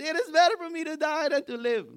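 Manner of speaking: high, loud melodramatic wail; a sulking prophet begging to die; despairing Jonah. Acted. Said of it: "And said".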